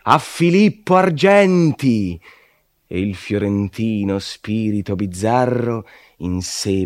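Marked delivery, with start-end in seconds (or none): 0.0-2.2 high, loud jeering shout of a mob; crowd shouting. A narrator's reading. Damned souls jeering at Filippo Argenti, loud shout. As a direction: cry the name out like a mocking crowd. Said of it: "Tutti gridavano".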